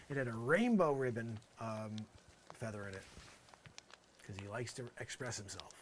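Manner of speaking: normal talking voice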